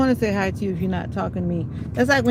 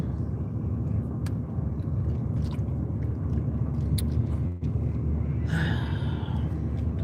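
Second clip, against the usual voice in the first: long deep sigh; 5 dB quieter than usual. Real, not acted.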